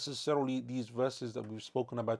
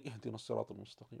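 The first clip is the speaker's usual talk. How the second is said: murmured fast under the breath, much softer; barely audible recitation; 3 semitones lower, 10 dB quieter, much faster than usual. Shown as a demonstration. Imam in silent prayer reading half aloud, quick soft murmur. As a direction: let the verse slip out just above silence. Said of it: "in a slightly audible voice".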